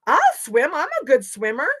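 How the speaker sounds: high, loud eager Raccoon; eager volunteer. A narrator's reading. Raccoon volunteers to swim for the animals, eagerly. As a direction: pipe up loudly to volunteer.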